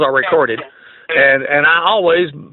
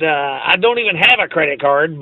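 ordinary delivery

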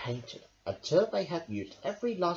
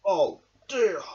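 frowning worry; 5 semitones higher, 7 dB louder than usual. A narrator's reading. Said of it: "Badger frowned".

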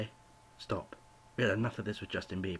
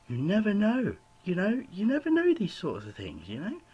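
much higher, louder, playful voice; high sing-song aside; 11 semitones higher, 7 dB louder than usual. Real, not acted. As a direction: toss it off in a high teasing tone. Sudden high lively aside.

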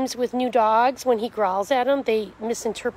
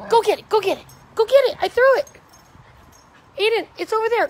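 high, loud fetch command; excited calling out; 9 semitones higher, 8 dB louder, faster than usual. Real, not acted. Owner sending her dog after the ball, excited calling out.

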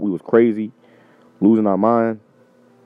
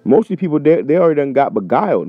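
fired-up preaching; 7 semitones higher than usual. Real, not acted. Heated warning to viewers.

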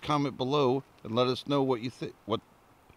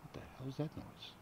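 hushed, spooked whisper; 2 semitones higher, 18 dB quieter than usual. Real, not acted. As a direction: whisper it nervously in the dark. Spooked by noise in woods.

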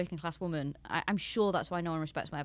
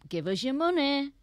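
raised-pitch, louder mocking demand; imitated grasping demand; mock money grab; 7 semitones higher, 5 dB louder than usual. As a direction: voice them greedily, high and pushy. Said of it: "they went a bit like".

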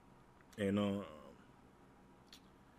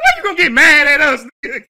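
high, loud words shaking with laughter; teasing laugh; 12 semitones higher, 18 dB louder than usual. Real, not acted. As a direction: laugh right in his face.

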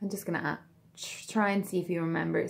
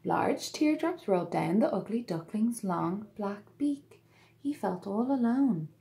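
put-on lively read-aloud to children; storybook narration; 3 semitones higher than usual. A narrator's reading. Narrator telling children the tale, bright and lively. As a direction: read it like bedtime story time.

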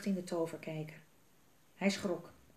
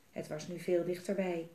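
usual speaking voice

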